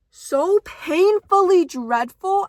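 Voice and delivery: slow, loud, dragged out; dramatic emphasis. Real, not acted. Vlogger ranting about romance books, slow and loud. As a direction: lean hard on every word.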